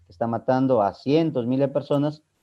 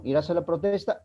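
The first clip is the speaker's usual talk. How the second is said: "¿Irás a la protesta?" pitched high, rising to the end; a yes-no question, lift the end of "¿Irás a la protesta?"; tono ascendente; 4 semitones higher than usual. Shown as a demonstration. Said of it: "es un tono ascendente". ("¿Irás a la protesta?" en tono agudo y ascendente)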